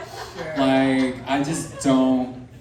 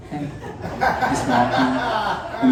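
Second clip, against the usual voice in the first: fake casual; 12 semitones higher, much slower than usual. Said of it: "I'm like".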